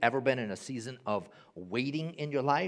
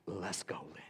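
soft whispered resolve; 8 semitones lower, 10 dB quieter than usual. Acted. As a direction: breathe it, no voice. Jesus quietly sets off.

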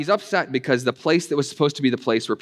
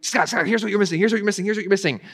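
higher, fast, pressing repeat; insistent objector; 7 semitones higher, faster than usual. Retold or imitated. Preacher voicing a critic who insists he missed something, eager and insistent. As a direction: mimic an eager critic cutting in. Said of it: "And then we'll say".

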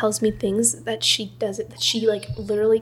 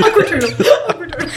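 laughing; 8 semitones higher, 8 dB louder than usual. Real, not acted. Laughing teasing chant.

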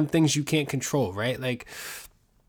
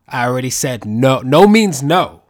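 mock shout; 12 dB louder than usual. Host mock-yells at his tickling wife. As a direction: yell it like a mock-offended victim.

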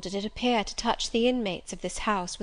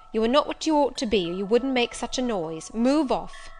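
brisk, raised-voice rebuke; scolding servant; 4 semitones higher, 5 dB louder than usual. Acted. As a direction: tell off a noisy beggar, louder and higher.

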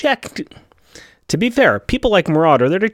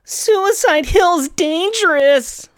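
even higher and slower, stretched; dramatic retake; 10 semitones higher, much slower than usual. Shown as a demonstration. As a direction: push the warning further. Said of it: "Let me try that again".

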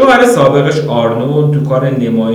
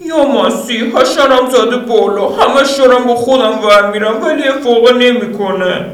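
much higher, lustful woman's voice; Lydia voice; 12 semitones higher than usual. Acted. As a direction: play Lydia, eager and teasing.

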